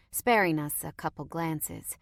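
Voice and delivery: ordinary delivery, nothing marked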